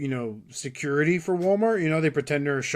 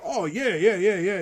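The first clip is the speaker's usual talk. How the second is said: higher, rapid-fire imitation of a tryhard; over-eager agreement; 6 semitones higher than usual. Tryhard fan faking agreement to keep chatting, over-eager. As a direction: agree too keenly, yeah upon yeah.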